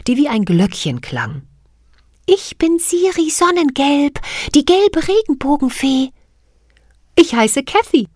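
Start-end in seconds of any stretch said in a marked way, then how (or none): 2.3-6.1 very high, clear little fairy voice; bell-like fairy voice. Acted. Siri voiced in story. 7.2-8.1 put-on raised, higher eager girl; young girl's voice. Cathy voiced in story.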